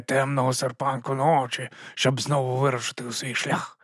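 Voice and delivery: hushed, dreamy intoning; poetic storytelling. A narrator's reading. Nobby describes the sleeping dragon to the guards, poetically intoning. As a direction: intone it softly like a legend. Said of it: "«Дуже поетично»".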